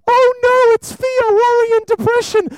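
high, loud mock panic. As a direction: shriek it like a scared kid. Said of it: "it chases you around".

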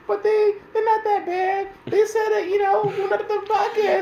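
a hammed-up squeaky fan begging for mercy; whiny fan voice. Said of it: "All our hardcore fans gonna be like".

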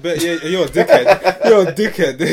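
mock insult through laughter; giggling jeer; laughing mock insult. Retelling boys mocking, laughing.

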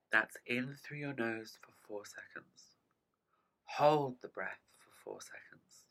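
slow, calm meditation guide; guided breathing. Acted. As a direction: talk them through each breath gently. Leads a breathing exercise.

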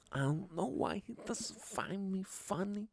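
lazy mock of the comedian, wounded and drawn out; shitty Sebastian Maniscalco impression. Podcaster mocking the comedian he finds unfunny, soft whiny voice. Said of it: "That's my shitty Sebastian Maniscalco impression".

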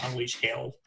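a mild, broad Australian unleash hell; a touch of broad Australian accent. Retold or imitated. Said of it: "dirty, gross Australian accent ever".

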